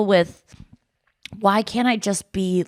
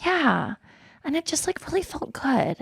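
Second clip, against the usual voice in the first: much softer and higher, dreamy gentle imitation; soft-spoken friend; 7 semitones higher, 6 dB quieter than usual. Parodying the cohost's serene voice, soft and high. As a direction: float the words out softly like a serene friend. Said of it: "I really wish that I talked like this".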